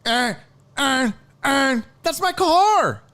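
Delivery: high, loud, excited yelp; excited shout. Retold or imitated. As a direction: shriek it like she just found it.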